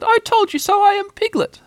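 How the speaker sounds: very high, loud squeal; squeaky vindication; triumphant. A narrator's reading. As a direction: crow it in a tiny creature's voice. Piglet triumphant.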